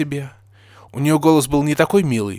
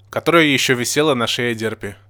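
usual speaking voice

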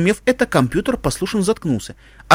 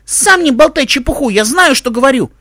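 put-on loud, high childish retort; indignant boy; 8 semitones higher, 5 dB louder than usual. Tim retorts to Mike in their quarrel, indignant high voice. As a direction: snap back like an offended child.

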